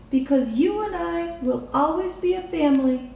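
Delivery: a daughter promising her mother; tender little girl. A narrator's reading. Lily promises Mama.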